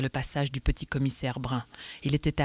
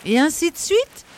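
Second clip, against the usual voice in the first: outraged shout; 13 semitones higher, 8 dB louder than usual. Acted. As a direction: shout "Et ainsi de suite?" high and loud, rising at the end. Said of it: "cria Danglars". (crier « Et ainsi de suite ? » fort et aigu, montée indignée)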